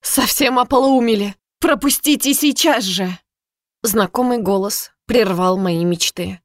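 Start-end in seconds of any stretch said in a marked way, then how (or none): 0.0-3.2 raised, indignant scolding; indignant demand. Acted. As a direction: demand to be let through, outraged. Geneva indignantly scolding guards.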